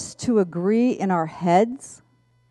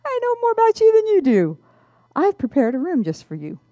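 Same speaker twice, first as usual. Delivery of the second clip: high, quick knowing bellhop voice; mysterious bellhop; 10 semitones higher than usual. Retold or imitated. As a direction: tease her like you know her secrets. Bellhop voiced in retold story.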